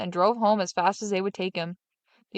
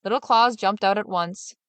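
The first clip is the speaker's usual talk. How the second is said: usual speaking voice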